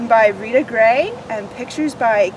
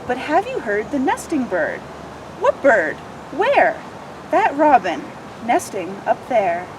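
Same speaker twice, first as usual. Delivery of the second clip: lively picture book read-aloud; expressive children's story narration; 2 semitones higher than usual. Acted. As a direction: read it like storytime for little ones.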